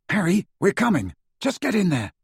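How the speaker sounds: high-pitched, insistent urging. A narrator's reading. Friend urging him into the tunnel.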